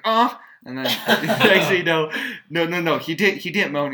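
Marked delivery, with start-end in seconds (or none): 0.0-0.4 sudden loud high yelp of shock; a baby's hammed-up cry as the jolt hits; mock scream. Newborn Shazam yelps as the eel shocks him, a loud mock scream. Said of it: "and he's like".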